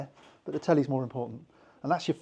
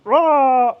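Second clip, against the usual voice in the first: a short, high, loud angry roar of frustration; frustrated roar; 10 semitones higher, 12 dB louder than usual. Retold or imitated. Storming out of the argument, angry roar. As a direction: let out a burst of rage.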